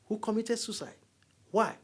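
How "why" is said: normal talking voice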